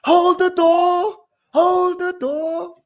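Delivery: very high, slow wail; Hodor cry. Retold or imitated. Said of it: "You never knew he came from".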